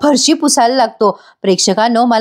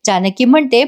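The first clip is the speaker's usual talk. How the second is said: ordinary talk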